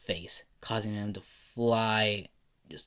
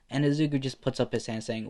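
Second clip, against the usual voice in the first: plain reading voice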